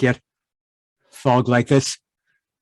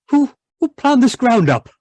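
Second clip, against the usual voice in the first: outraged; 8 semitones higher than usual. Acted. Husband outraged at plowed ground.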